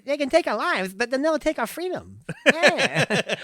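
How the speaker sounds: very high talk-show host attempting Scottish; comic high impression with muddled Scottish accent; Jay Leno. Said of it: "Now do Jay Leno doing Scottish".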